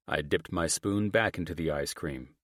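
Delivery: plain reading voice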